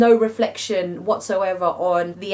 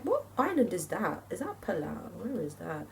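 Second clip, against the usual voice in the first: soft, trailing off much quieter; talking to oneself; 11 dB quieter than usual. Real, not acted. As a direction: puzzle it over under your breath. Puzzling over map to herself.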